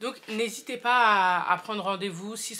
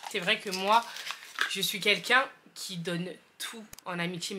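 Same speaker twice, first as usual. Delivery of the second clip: normal talking voice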